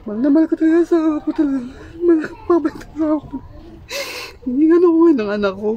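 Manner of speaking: sobbing, high breaking voice; fast tearful outburst, choked up; crying. Real, not acted. Breaks down, apologizes while crying.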